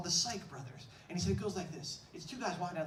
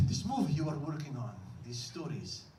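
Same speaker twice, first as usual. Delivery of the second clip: put-on Egyptian accent; 6 semitones lower, 3 dB louder than usual. Clerk solving the movie ending, prophetic Egyptian accent. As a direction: pronounce it like a wise oracle, Egyptian accent. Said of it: "he says in a heavy accent".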